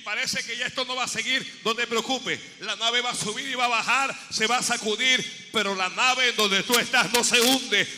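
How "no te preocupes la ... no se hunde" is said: loud, building sermon shout; preaching crescendo. Acted. Preacher assuring the church, building to a loud cry. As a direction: build it up till the ship won't sink.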